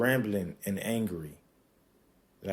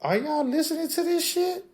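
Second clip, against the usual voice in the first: incredulous; 4 dB louder than usual. Retold or imitated. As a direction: stretch it out in high astonishment. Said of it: "I'm like".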